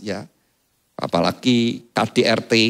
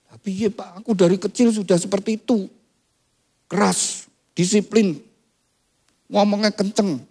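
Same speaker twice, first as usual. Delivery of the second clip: lebih tinggi, membela diri dengan polos; suami membela diri; 9 semitones higher than usual. Retold or imitated. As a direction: seperti pria keras yang mengeluh. Suami membela diri pada pendeta. (suami keras mengeluh kepada pendeta, nada tinggi)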